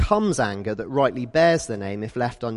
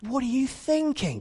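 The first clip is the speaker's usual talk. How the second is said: incredulous; 11 semitones higher than usual. Retold or imitated. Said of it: "I was".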